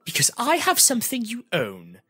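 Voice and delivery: high, loud sing-song man; lilting sing-song trickster; Halvard's voice. Acted.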